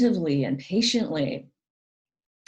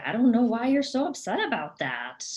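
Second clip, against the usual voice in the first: mock dismissive puzzlement, pitched up; 6 semitones higher than usual. Narcissist brushing off the other person's feelings, mildly dismissive and higher. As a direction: lightly act out someone brushing off feelings, higher. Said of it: "dismiss your feelings".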